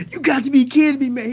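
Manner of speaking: high, loud excited yell; hyped disbelief. Retold or imitated. Friend on the phone, hyped and loud. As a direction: shout it like you can't believe it. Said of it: "He was so hyped".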